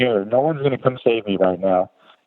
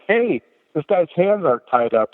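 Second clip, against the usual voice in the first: startled shout; 7 semitones higher than usual. Retold or imitated. Medic startled by a punch.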